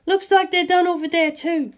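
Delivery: put-on a relieved young woman; Lisa Lisa. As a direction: say it lightly, looking down. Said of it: "Lisa Lisa said".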